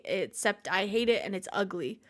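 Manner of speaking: ordinary talk, nothing marked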